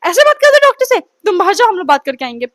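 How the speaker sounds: mom scolding. Retold or imitated. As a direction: snap at the daughter like an angry mom. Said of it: "mummy मेरे को बीच में डांटती है". (डांटती हुई मम्मी, ऊँची और तेज़ आवाज़ में)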